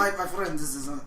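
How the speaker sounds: put-on foreign accent, slipping off German; wrong accent, faked. Trying to answer in German, badly faked accent. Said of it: "that's the wrong accent".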